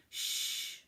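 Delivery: soft unvoiced shh; shushing. Everyone hushing the bears, whispered shh. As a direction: hush together. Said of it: "said everyone".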